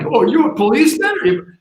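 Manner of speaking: high mocking retort; high sarcastic voice; cool vandal retort. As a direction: toss it back coolly, mocking. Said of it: "the guy was like".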